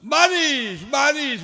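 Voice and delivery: high, loud repeated cry of the word; mother crying out about rain, hammed; mock fussy mother. Mother fussing over rain, loud mock cry.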